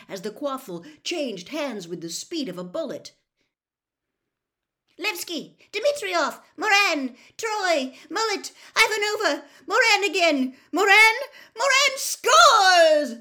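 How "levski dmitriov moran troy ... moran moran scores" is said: roaring sports commentary, stretched final word; excited match commentator. Acted. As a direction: call the play at full volume, climbing to the goal cry. Match commentator calling the play.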